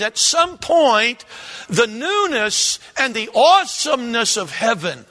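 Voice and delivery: fervent, loud preaching. Acted. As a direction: preach it with full force. Preaching at full force.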